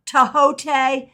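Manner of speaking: "Tahote" as tuh-HOH-tay, three syllables; three-syllable pronunciation. Shown as a demonstration. Teacher giving the last variant, "Tahote" as tuh-HOH-tay. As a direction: say "Tahote" with a sounded H and final "tay". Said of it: "depending on how you pronounce that".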